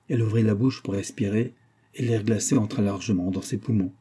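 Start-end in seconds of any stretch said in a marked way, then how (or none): none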